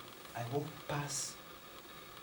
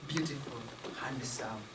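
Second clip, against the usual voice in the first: plain reading voice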